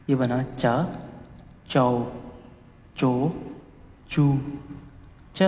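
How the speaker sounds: चा, चाओ, चो each said apart with a pause; patient read-through of the chart row; syllable drill. Shown as a demonstration. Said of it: "तो इसकी पहली ही sound आएगी च".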